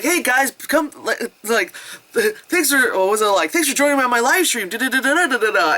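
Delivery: high chirpy Sonic imitation; upbeat streamer voice. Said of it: "looks at it and goes".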